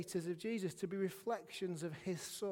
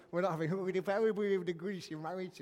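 put-on puppet voice, slightly louder; puppet impression; 4 dB louder than usual. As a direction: slip into a silly puppet voice. Slips back into puppet impression.

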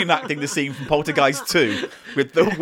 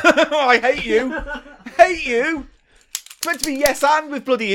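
laughing exasperation; 8 semitones higher than usual. Real, not acted. Exasperated with co-host's monkey bit.

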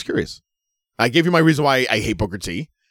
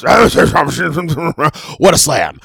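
Booker T impression; 8 semitones higher, 9 dB louder, much slower than usual. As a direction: ham up the slow wrestling announcer call. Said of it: "everything is".

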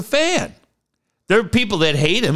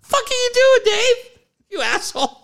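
mock outrage; 13 semitones higher than usual. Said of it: "You'd be like".

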